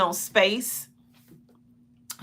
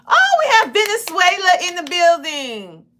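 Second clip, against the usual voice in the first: excited shout-out; 9 semitones higher, 5 dB louder than usual. Real, not acted. Host hailing a viewer from Venezuela, excited shout-out. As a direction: call it out high with delight.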